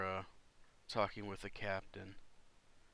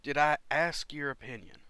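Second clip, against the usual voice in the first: loud, higher-pitched indignant comeback; affronted, raised-voice rebuke; irritated retort; 5 semitones higher, 9 dB louder than usual. Acted. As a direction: snap back at unwanted advice. Armored character rebukes a player's character.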